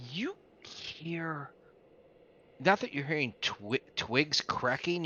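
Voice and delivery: hushed breathy whisper. Acted. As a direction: whisper it like a secret. GM whispers a sound cue.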